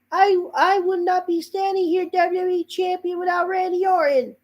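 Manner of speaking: high mock promo voice of Cody; Cody Rhodes impression. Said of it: "I'm gonna speak in my normal voice".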